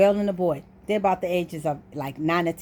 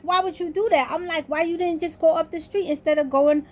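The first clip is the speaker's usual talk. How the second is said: higher-pitched retold exasperation; pissed off; 8 semitones higher than usual. Dreamer asking friend's mother why she turned back, mildly pissed. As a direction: re-enact a peeved, high-pitched complaint. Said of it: "And I'm pissed".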